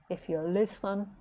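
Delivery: usual speaking voice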